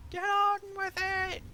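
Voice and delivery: high cartoonish echo of the chant; Monty Python squeak. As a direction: squeak it like a silly Python character. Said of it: "Like Monty Python".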